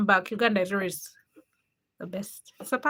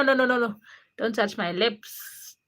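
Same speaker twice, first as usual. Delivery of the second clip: normal talking voice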